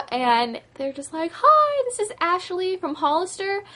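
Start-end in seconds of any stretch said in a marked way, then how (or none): 1.3-3.6 put-on high, bright-toned sales-girl greeting, pitched well up; mock perky retail caller voice. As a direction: chirp it like a cheerful store rep on the phone. Retold call from store employee.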